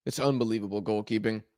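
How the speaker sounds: normal talking voice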